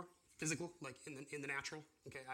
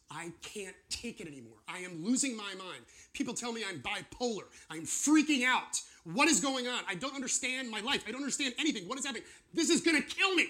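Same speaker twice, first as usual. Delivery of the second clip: panicked believer; 8 semitones higher, 9 dB louder than usual. Retold or imitated. Preacher voicing a believer losing their mind, rising panic. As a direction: voice climbing higher and louder to a frantic peak.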